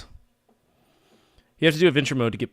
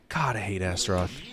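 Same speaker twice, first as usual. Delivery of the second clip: ordinary talk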